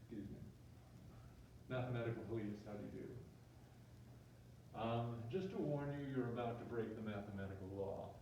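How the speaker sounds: low, soft mock-official cop; lightly voiced cop giving a polite warning; police officer. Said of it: "the cop comes over and says".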